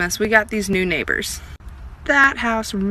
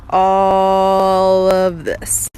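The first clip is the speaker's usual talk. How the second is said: very slow and louder, savoring it; slow triumphant reveal; 4 dB louder, much slower than usual. Real, not acted. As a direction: unveil the display like a trophy. Showing off big display.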